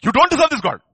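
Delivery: high, loud accusing shout; voice of the devil. Acted. Devil condemning a believer, loud accusing shout. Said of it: "That is the voice of the devil".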